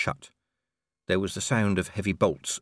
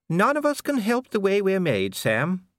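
much higher, gently reproachful lady; Lady Sybil; 11 semitones higher, 4 dB louder than usual. A narrator's reading. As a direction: chide your husband kindly. Sybil scolds Sam gently.

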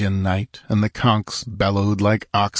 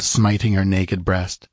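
ordinary delivery

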